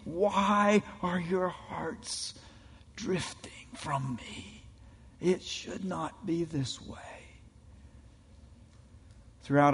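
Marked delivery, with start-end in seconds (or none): 0.0-7.0 soft, slow, near-whispered grief; heartbroken plea. Acted. God pleading with his drifting people, heartbroken and hushed. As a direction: ache through every word, fading to a breath. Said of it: "God with heartbreak and tears saying".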